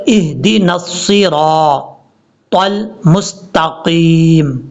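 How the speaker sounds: slow, held 'اهدنا الصراط المستقیم'; correct recitation. Shown as a demonstration. Teacher models the next verse, slow correct recitation. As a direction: draw out 'الصراط المستقیم' with heavy consonants. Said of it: "break نہیں پڑھنا".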